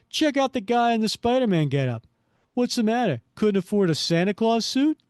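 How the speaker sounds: high, loud mocking thug; jeering barfly. Barfly taunts the man in costume, jeering.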